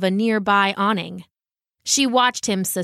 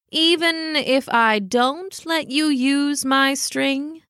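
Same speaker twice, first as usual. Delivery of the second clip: slow feline question, pitched higher; testing cat; 4 semitones higher, slower than usual. Acted. Cat testing the dog's offer of friendship, slow and doubtful. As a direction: probe slowly in a cat's voice.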